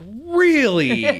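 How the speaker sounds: high, loud disbelieving "really"; incredulous. Retold or imitated. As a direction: sound like you can't believe it. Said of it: "I'm like".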